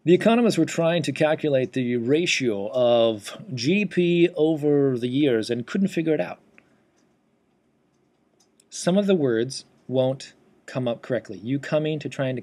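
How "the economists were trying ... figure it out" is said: loud, blurred run-on dictation; loud, slurred test sentence; dictation mishap demo. Shown as a demonstration. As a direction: rattle off the sentence loudly with the words running together. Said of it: "if I say something like".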